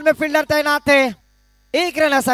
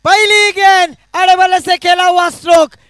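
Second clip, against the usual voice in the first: loud, high cricket commentary shout; excited boundary call; 4 semitones higher, 6 dB louder than usual. Real, not acted. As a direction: roar out the four to the crowd. Commentator calls a boundary four. (जोश भरी चौके की commentary)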